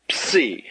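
"psi" breathed out, unvoiced; whispered psi. Shown as a demonstration. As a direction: hiss "psi" with no voice. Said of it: "The next letter".

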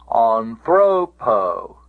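anthropo very slow, stress on "thro"; stress on penult. Shown as a demonstration. Teacher showing the dative case, anthropo stressed on "thro". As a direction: stretch and hit the middle syllable "thro" in anthropo. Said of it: "the accent cannot get back".

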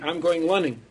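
"lunning" opening on L instead of R. Retold or imitated. Korean colleague telling Jimmy she's off jogging, L for R. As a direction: say "lunning" casually, L where the R belongs. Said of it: "It's always an L sound".

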